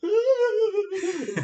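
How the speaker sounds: high, loud crying wail; high mock wail. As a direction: wail like frightened women. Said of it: "women are like".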